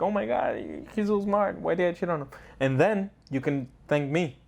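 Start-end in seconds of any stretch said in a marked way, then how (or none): 0.0-2.3 put-on higher, impressed girlfriend voice; lightly voiced girlfriend, dazzled and regretful; mock girlfriend. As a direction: boyfriend mimics his girlfriend's admiration, a touch higher. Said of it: "she's gonna be like".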